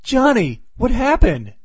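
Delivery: high-pitched, alarmed question; imitated wife calling out in concern; [name]. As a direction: ask it high, as a worried wife. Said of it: "and [name] asks".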